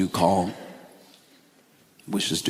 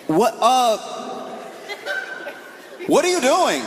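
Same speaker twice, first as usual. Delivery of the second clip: much higher, baffled friend; confused friend voice. Retold or imitated. Friend on the phone, high and baffled. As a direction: squeak out a startled what are you doing.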